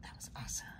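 quiet amazed whisper. Real, not acted. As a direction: whisper it, still thrilled. Whispering near spider.

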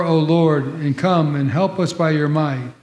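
ordinary talk, nothing marked

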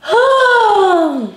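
a high crying sigh; tearful sob-sigh. Real, not acted. Moved to tears by her cousin's card, high sobbing sigh. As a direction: let the tears break out in a sigh. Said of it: "I always cry".

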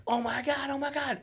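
high-pitched mock gushing; excited crowd. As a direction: imitate a starstruck crowd in a high voice. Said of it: "So everybody's like".

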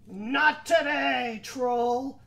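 slow, loud defiant refusal; defiant goat. Acted. Biggest goat refuses the troll, slow and defiant. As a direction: draw it out coolly.

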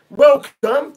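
loud, high welcome to the crowd; announcer's shout. Pretend. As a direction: belt out a big host's greeting. Announces arrivals to the stream.

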